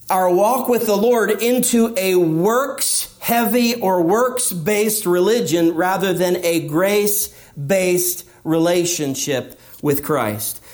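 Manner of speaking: usual speaking voice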